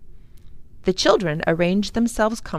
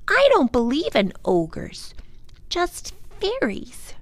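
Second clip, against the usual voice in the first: put-on little girl voice; 7 semitones higher than usual. A narrator's reading.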